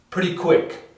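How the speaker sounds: ordinary talk, nothing marked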